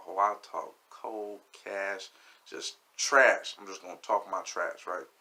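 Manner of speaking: normal talking voice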